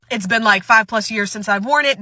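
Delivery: ordinary delivery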